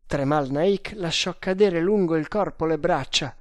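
usual speaking voice

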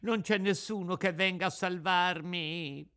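high, wailing puppet cry for help; crying and shrieking. A narrator's reading. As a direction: wail pitifully in a high voice, acted. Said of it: "cominciò a piangere e a strillare".

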